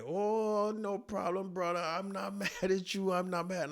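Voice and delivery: put-on high African-accented reassurance; calm him down in an African accent. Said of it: "he's like".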